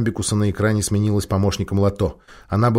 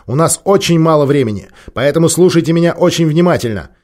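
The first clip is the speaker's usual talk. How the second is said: loud, authoritative, higher pitch; commanding tone; 7 semitones higher, 7 dB louder than usual. A narrator's reading. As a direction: take charge and cut her off. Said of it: "Он властным тоном перебил".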